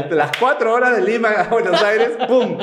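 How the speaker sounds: high, laughing rush of words; giggling through the story, fast. Real, not acted. Telling friends about the trip, laughing.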